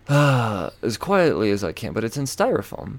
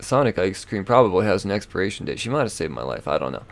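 ordinary talk

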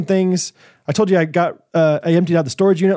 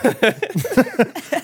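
high giggle; 8 semitones higher than usual. Real, not acted. Laughing after the mock taunt.

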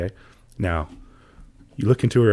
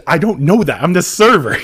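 louder and higher mock protest; playful indignation; 8 semitones higher, 9 dB louder than usual. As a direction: insist loudly that you're only the waiter. Server defends role, playfully indignant.

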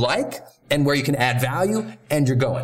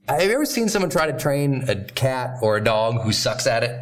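ordinary talk, nothing marked

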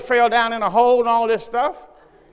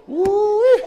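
excited exclamation; 11 semitones higher, 4 dB louder than usual. Real, not acted. Preacher exclaiming about hell.

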